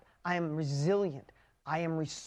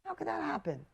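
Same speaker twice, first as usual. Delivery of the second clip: mock wounded disbelief; 8 semitones higher, 5 dB quieter than usual. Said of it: "And I'm like".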